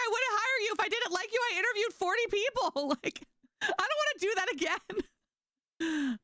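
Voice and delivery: very high, laughing retold protest; high-pitched disbelief, breaking into laughs; incredulous reassurance. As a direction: squeal it in comic exasperation.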